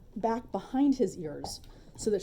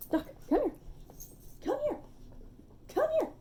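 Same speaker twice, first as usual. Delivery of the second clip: very high-pitched recall; high sing-out to a pet; calling a dog; faster than usual. Real, not acted. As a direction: call the dog over in a high voice. Calling her dog Doc over.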